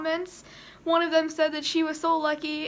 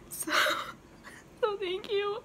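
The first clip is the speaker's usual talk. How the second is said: ordinary talk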